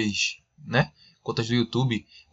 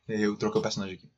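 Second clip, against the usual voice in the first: usual speaking voice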